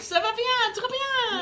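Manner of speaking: very high, loud mock French; French accent. Co-host answers own greeting in French, very high hammed-up French accent. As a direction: squeal it in an exaggerated French accent. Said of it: "she's heard your French accent".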